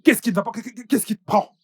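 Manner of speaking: loud, high, furious questions; angry scolding. Acted. Boss yelling at his employee, furious. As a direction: yell at the worker.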